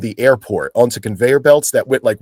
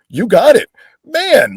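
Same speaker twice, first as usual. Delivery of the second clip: eager yes; 10 semitones higher, 5 dB louder, slower than usual. Retold or imitated. Eager reply to the crew.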